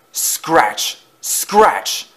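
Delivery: high, sudden scratching sound word; scratching noise. A narrator's reading. Noises wake Thomas at night, high scratchy exclamations.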